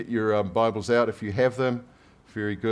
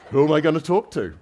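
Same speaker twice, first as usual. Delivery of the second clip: put-on higher, louder puzzled old man; lonely foreman; 7 semitones higher, 9 dB louder than usual. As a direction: wonder it aloud, bemused. Foreman's thought, acted.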